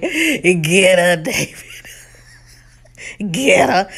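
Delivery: loud, laughing goading from the couch; amused egging-on. Retold or imitated. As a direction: cheer him on through laughter. Cheering on David at home, laughing.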